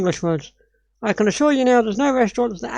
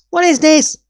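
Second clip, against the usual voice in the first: higher and louder, disbelieving; shocked question; 6 semitones higher, 4 dB louder than usual. Real, not acted. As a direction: react as if you can't believe your eyes. Shocked at inaccurate video footage.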